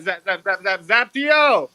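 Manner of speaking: building hype, drawn out and rising; hype announcer call. Real, not acted. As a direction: call the name like a ring announcer. Hyping up the next speaker.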